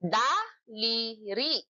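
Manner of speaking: drawn out slowly, syllable by syllable; slow syllabified "Daliri". Shown as a demonstration. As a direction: stretch the word out. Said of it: "Ilang pantig?".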